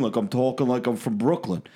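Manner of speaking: usual speaking voice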